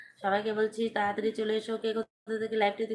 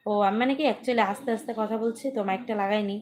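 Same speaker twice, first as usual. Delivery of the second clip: louder, projecting to be heard; louder explanation without a mic; raised voice; 6 dB louder than usual. Real, not acted. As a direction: speak up for a listener who can't hear. Viewer says her sound is low.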